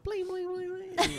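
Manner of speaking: very high, light repeated blings; playful bling sound effect. Pretend. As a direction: sparkle it out high, like magic. Acts out wishes appearing.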